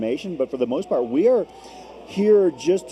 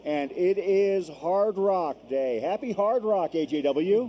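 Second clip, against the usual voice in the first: bright, high welcome to the viewers; upbeat travel show host; 7 semitones higher than usual. Acted. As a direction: open the show like a cheerful TV host. Broadcast opening to viewers.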